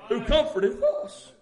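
fiery sermon climax; preaching at full force. Acted. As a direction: whoop it up to the congregation.

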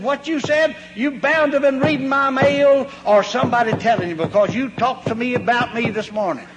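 offended church member scolds the pastor, mock angry; mock indignant accusation.

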